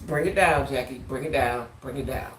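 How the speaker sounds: low, soft talking herself down; calming self-talk. Retold or imitated. Woman quietly calming herself before cussing out her date. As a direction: hush yourself before you blow up.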